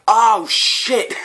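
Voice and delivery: high, loud startled yelp; mock alarm. Me reacting to the stranger's jab, mock alarm. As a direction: squeak it in comic panic. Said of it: "And I was like".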